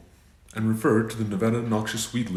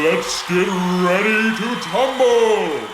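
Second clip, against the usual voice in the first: higher, louder, drawn-out slow hype call; booming fighting game announcer; 10 semitones higher, 9 dB louder, much slower than usual. Acted. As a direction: stretch it out like a prize-fight announcer. Fight intro catchphrase.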